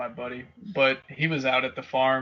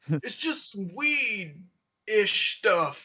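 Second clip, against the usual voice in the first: slow, drawn-out higher voice; kid's reply; 6 semitones higher, much slower than usual. Retold or imitated. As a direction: imitate a kid stretching out a feeble explanation. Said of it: "This kid's like".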